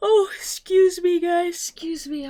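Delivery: talking through a big yawn, high and loud. Real, not acted. Streamer apologising to chat mid-yawn, yawning. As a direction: stretch the words through a yawn. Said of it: "I don't know why I'm yawning".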